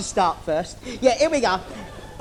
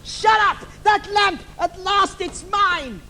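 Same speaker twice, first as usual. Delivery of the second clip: villain shout; 9 semitones higher than usual. Acted. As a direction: bark then savour the win.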